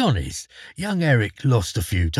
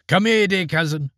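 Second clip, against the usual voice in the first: put-on lightly higher, coaxing young man; coaxing call; 6 semitones higher than usual. A narrator's reading. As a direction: beckon the dead cousin a bit playfully. Eric calls to coffin.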